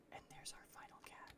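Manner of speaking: soft breathy whisper with no voice; hushed whisper. Real, not acted. Spotting the last cat, hushed whisper. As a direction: keep it hushed so the cat won't run.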